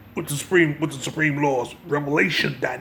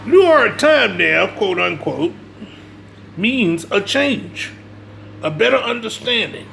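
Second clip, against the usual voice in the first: sermon delivery, pitch raised and pushed; preaching; 6 semitones higher than usual. Acted. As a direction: preach it to the congregation with conviction. Preaching to listeners about history.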